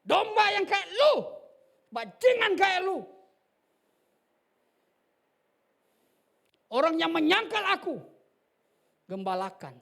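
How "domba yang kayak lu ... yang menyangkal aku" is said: much higher and louder outcry; preaching shout. Real, not acted. Preacher telling the church who the sheep are, shouting. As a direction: hurl it at the listeners.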